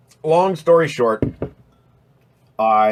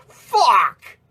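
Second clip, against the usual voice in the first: exasperated outburst; 11 semitones higher, 4 dB louder than usual. Real, not acted.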